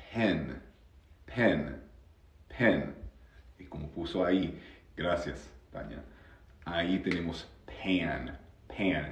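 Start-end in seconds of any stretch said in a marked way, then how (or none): 0.1-2.9 "pen" with a plain English e; English short e. Shown as a demonstration. Teacher warns learners who write an E, clear short-e "pen". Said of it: "en inglés ese sonido es". 7.7-9.1 "pan" with a vowel between a and e; nasal English "pan", a gliding toward e; blended a-e vowel. Shown as a demonstration. Teacher shows Spanish speakers the mixed vowel, "pan" between a and e. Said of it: "una mezcla entre la A y la E".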